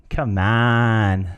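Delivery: drawn-out, wheedling encouragement; coaxing. Shown as a demonstration. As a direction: coax someone into just trying it. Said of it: "Just try it".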